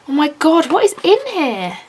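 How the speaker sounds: rising thrill at what's inside; delighted, curious exclamation; excited curiosity. Real, not acted. Opening the package.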